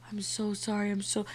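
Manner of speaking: whispered retold apology; hushed apology. Apologising to ex the next day, hushed whisper. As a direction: whisper it, softly remorseful.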